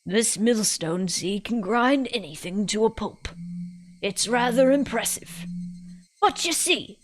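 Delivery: put-on British old lady spinning a yarn; old woman explaining with swooping emphasis; old person, British. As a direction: ramble on like a British granny. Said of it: "all my old people voices turn British".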